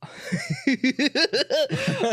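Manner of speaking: long, high-pitched laugh. Real, not acted. Game master teased by player's echo, long high laugh. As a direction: crack up high and helplessly. Teasing echo of GM's question.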